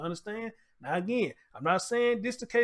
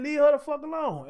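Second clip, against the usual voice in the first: raised, higher mock yell; a retold shout, louder and higher; retold scream; 6 semitones higher, 5 dB louder than usual. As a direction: echo her yelling at them, pushed up but not full force. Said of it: "She was screaming, saying".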